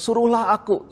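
eager plea. Retold or imitated. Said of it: "Petrus minta Tuhan".

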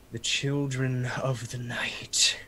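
slow, breathy villain whisper. Acted. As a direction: hiss it slowly like a vampire. Strahd savoring the night.

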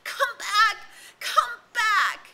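high, loud desperate scream. A narrator's reading. Ralph screaming at the vanishing ship, desperate. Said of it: "and screamed at the ship".

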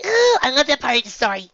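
put-on loud, high gushing girl; high excited gush. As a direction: gush it eagerly. Nora character voice.

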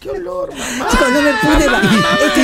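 voz aguda de esposa desesperada; esposa chillona. Acted. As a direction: chillar con llanto fingido. Esposa actuada, chillido, risa y llanto. (esposa que chilla entre risa y llanto)

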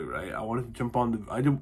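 normal talking voice

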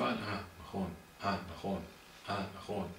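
hushed acknowledgement; quiet realisation. Acted. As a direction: murmur it as if just reminded. Acts someone being reminded, nodding along.

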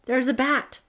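high, loud panicked gasp. A narrator's reading. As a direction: blurt it out in fright. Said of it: "Holly gasped".

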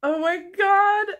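high and loud, oh my God bursting out; sudden overwhelmed outburst over the gift; overwhelmed gasp. Real, not acted. Vlogger opening the package, overwhelmed and thrilled.

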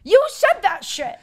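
high, loud, slow shocked call-out; outraged disbelief; shocked outburst. Real, not acted. Friend shocked, calling out.

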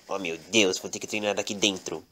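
mais grave e bem mais baixo, desanimado; resmungo baixinho. Acted. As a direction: resmunga para si, quase sem voz. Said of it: "E ele falou assim". (Lorenzo Médio resmunga que vai treinar dentro, baixinho)

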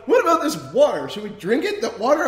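dopey earnest question; stoned friend asking. Retold or imitated. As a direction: friend asks whether to drink bong water. Imitating Brian, stoned question.

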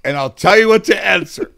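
loud, high, insistent grandmother voice; grandmother's instructions. Retold or imitated. Grandmother coaching mom before court, loud and insistent. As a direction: drill it in like a bossy grandmother. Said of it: "My grandmother said".

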